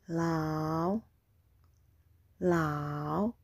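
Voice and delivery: "Ma", "pa", "Ye", "Lao" drawn out, dipping then rising; falling-rising tone. Shown as a demonstration. Teacher drills second tone on "Ma" to "lao", falling-rising. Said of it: "The second tone is the falling rising tone".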